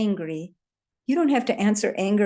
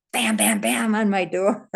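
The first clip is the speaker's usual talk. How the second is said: ordinary talk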